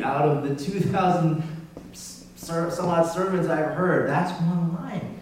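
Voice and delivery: ordinary delivery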